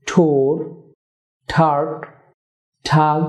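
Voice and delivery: ঠেন ঠু ঠি each begun with a puffed t; aspirated t words. Shown as a demonstration. Teacher drilling t-initial words for viewers. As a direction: push air after every opening t. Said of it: "টি দিয়ে word শুরু".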